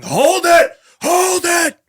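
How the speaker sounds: 3 yell it twice, desperate to be heard. Acted. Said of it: "You hear Rolo and Sheg yelling".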